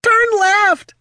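high, loud mechanical squeal; a shrill gadget barking orders; squeaky robot voice. Acted. Mechanical gazer nags.

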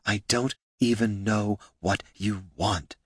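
soft, breathy and weary; breathed. Acted. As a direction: sigh it out, exhausted. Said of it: "breathed Loruna".

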